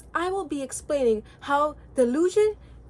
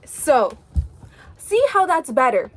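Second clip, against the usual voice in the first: usual speaking voice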